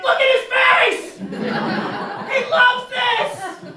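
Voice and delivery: very high, loud excited yell; gleeful shouting. Acted. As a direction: shout it with delight, stretching the end. Family yelling gleefully back.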